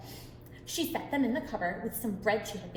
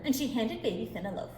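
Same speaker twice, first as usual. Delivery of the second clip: ordinary delivery, nothing marked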